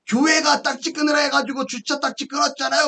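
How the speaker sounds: imitated indignant complaint; angry caller. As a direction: act out the irate caller. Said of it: "막 화를 내는 거예요".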